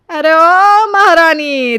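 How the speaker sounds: slow, loud sarcastic taunt; mocking sneer. Mother-in-law taunts the bride as a queen, slow and loud. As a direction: drag out the mock title.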